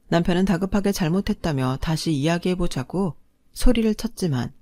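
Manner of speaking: usual speaking voice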